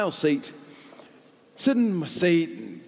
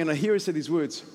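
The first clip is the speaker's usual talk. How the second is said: ordinary talk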